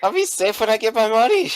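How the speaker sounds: high, stubborn character voice; panicking shop owner. Acted. Store owner refuses to flee without money, high-pitched insistence. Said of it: "he's kinda panicking".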